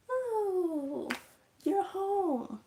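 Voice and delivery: high, sweet fake-cheerful greeting; the aunt's high, syrupy hello; sugary aunt. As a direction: put on a falsely sweet welcome. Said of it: "she's acting all".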